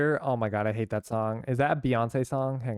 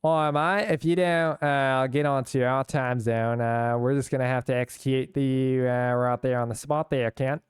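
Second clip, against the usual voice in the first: put-on Australian accent. Australian friends threatening over time zones, mock Australian accent. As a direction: imitate Australian friends with a hammed-up Aussie accent. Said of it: "plenty of my Australian friends, they were like".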